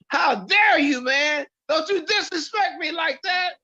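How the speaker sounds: hammed-up mock fury at the valet; mock outrage. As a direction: pretend to be deeply insulted, hammed up. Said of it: "I just went off on the guy".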